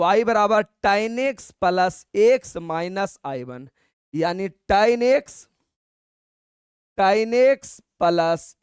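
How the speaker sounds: drawn-out, word by word; teacher reading the answer at writing speed; slow dictation. Shown as a demonstration. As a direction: dictate the formula slowly for copying.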